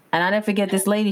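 usual speaking voice

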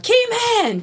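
high drawn-out call; high-pitched re-enacted yell; retold screaming. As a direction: holler it high to someone far away. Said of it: "I'm jumping and screaming".

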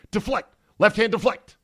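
high, loud mock coaching; coaching shout. Real, not acted. Host showing the fan how to block a hot dog, loud coaching call. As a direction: bark it like a coach drilling a player.

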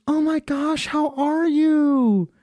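very high, drawn-out fake-sweet squeal; mock gushing girl. Old classmate greeting him at Subway, mock gushing. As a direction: imitate an overfriendly girl, stretching the words. Imitates woman gushing on reunion.

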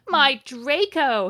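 loud, high, drawn-out lament; moaning. A narrator's reading. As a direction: moan the name slowly and theatrically. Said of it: "he moaned".

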